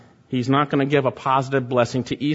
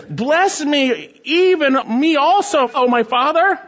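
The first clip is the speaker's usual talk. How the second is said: higher, louder, begging wail; bitter pleading cry; 10 semitones higher, 4 dB louder, slower than usual. Acted. Esau begging his father for a blessing, a pleading cry. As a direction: plead with the father through the tears. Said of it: "cried out with an exceedingly great and bitter cry".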